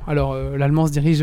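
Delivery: ordinary delivery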